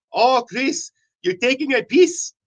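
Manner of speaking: lightly imitated Scottish accent, pitched high; Scottish friend. Scottish friends teasing a drunk man, light imitated Scottish accent. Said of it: "my Scottish friends are saying".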